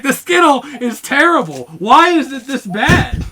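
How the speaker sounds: loud, high mock-furious complaint; imitating a friend's angry yelling; retold angry outburst. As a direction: wail it in hammed-up outrage. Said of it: "he got so mad".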